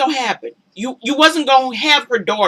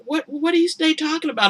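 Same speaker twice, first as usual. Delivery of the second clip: higher, soft puzzled question; confused Amina; 7 semitones higher, 5 dB quieter, faster than usual. Retold or imitated. To her man, puzzled Amina asking what they mean. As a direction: play her baffled asking. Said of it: "Amina's like".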